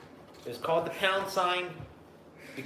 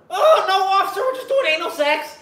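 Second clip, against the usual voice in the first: high, loud breezy answer to the officer, laughing; mock cheerful reply; 10 dB louder than usual. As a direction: chirp it innocently to the cop.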